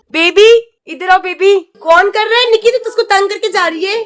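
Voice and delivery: high, loud mothering coo. Real, not acted. Mother calls upset toddler over, high fussing coo.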